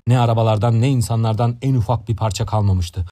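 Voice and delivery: usual speaking voice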